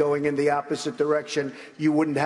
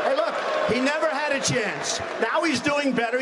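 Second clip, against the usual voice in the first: pitched up and pushing hard over the crowd; higher, insistent rebuttal at a debate; raised voice; 9 semitones higher than usual. Real, not acted. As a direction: drive the point home with a raised, higher voice. Heated debate exchange, rebutting.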